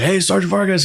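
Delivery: high-pitched mock call-out; retold hearty greeting. Veteran imitating Tony greeting him, high hailing voice.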